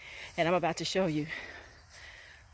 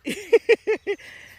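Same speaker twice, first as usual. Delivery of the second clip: loud high laugh; 9 dB louder than usual. Real, not acted. Laughs after hype call.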